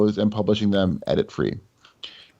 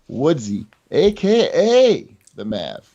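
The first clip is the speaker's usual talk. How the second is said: slow high announcer call; hype announcer; 5 semitones higher, slower than usual. Acted. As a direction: announce him like a fighter. Hyped announcer introduction.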